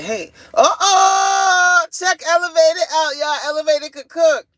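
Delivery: high, loud drawn-out hype shout; hyped-up hollering. Acted. Hollers out a viewer's cooking.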